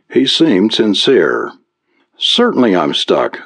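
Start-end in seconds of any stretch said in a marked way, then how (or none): none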